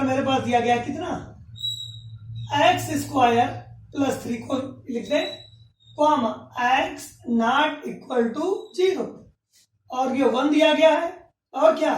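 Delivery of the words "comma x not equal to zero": each word spaced out slowly; slow dictation. Shown as a demonstration. Teacher dictating the condition slowly to students. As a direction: read the condition out slowly for the class to copy.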